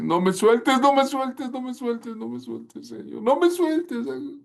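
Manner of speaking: high, begging repeated don't let go; cling on and beg, fading; clinging plea. Acted. Peter clinging to the Lord.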